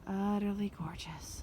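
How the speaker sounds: slow awed whisper. Real, not acted. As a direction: breathe it out in a whisper. Admiring the view twice.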